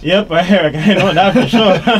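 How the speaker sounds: said with a rueful laugh. Real, not acted. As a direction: laugh it out, caught out by the coach. Host admits homework struggles.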